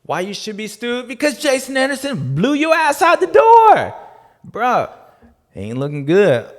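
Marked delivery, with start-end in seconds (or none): 0.0-4.9 mock outraged. Roasting a rider.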